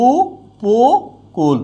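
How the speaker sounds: উপকূল drawn out slowly; slow demo pronunciation. Shown as a demonstration. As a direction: stretch উপকূল as a model. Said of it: "teacher demonstrates pronunciation".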